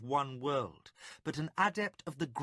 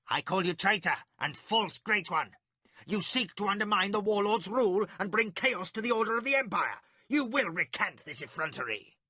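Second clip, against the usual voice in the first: angry denunciation; 7 semitones higher, 5 dB louder than usual. Acted. Magician denounces Milimber.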